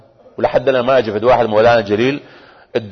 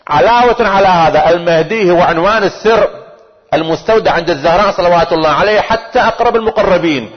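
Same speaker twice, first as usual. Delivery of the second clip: impassioned preaching; 7 semitones higher than usual. Acted. Preacher telling the congregation of the Mahdi's hidden birth, impassioned preaching. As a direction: proclaim it as a wondrous mystery.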